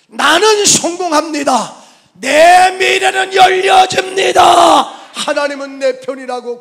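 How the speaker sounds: high, booming drawn-out shout; triumphant declaration. Acted. Preacher shows the congregation how to confess success, triumphant shouting. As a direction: proclaim it at full force, stretching the words.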